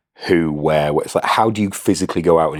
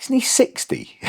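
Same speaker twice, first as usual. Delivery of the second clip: incredulous; slower than usual. Retold or imitated. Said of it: "And I'm like".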